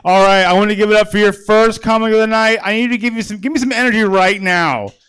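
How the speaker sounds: loud, high emcee call; hype announcer. Real, not acted. Host bringing up the first comic, loud hype. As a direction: pump the crowd up for the next act.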